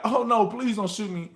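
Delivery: high, begging for his life; cowardly pleading. Retold or imitated. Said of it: "play the coward role and be like".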